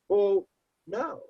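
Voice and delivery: high, sing-song imitation of the caller; mock nurse voice.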